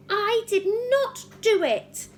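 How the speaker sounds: high, loud, slow scream; screamed denial. Acted. Matilda screams her innocence at the headmistress. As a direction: scream it out, stressing not. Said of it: "she screamed".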